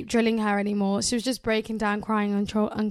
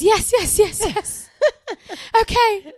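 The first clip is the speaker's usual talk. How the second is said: put-on crying girlfriend; 11 semitones higher, 8 dB louder, slower than usual. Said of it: "Still crying at this point".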